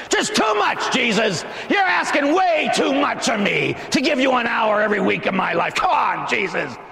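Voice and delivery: high, shouted exasperated whine; mock complaining. Preacher mimicking reluctant churchgoers refusing an hour, mock-indignant shout.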